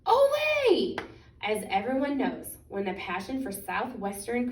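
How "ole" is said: very high, loud drawn-out Ole; a loud squealing party cheer; pigs cheering. A narrator's reading. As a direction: hold it long and high like a chorus of pigs. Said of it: "and the pigs said".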